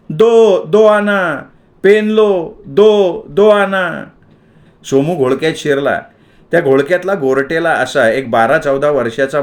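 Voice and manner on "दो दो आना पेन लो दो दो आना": high, loud street cry; hawker shouting. A narrator's reading. Pen seller shouting to the crowd, loud street cry. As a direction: bellow the price at passers-by. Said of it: "एक जण मधूनच ओरडत होता".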